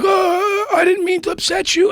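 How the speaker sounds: high, whiny mock apology; mock old timer. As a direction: squeak out a fake-innocent apology like the old cop. Old timer backing down, imitated.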